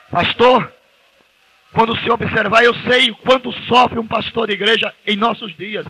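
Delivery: high, pressing preacher voice; impassioned preaching. Acted. Preacher telling pastors of his pain, high and pressing. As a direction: plead with the pastors, heart sore.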